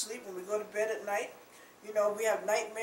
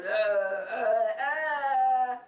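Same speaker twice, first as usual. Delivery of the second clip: mock retching groans; 3 semitones higher, 8 dB louder, much slower than usual. Preacher acting out a sinner barfing, loud retching groans. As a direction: heave it out like vomiting hard. Miming retching over the toilet.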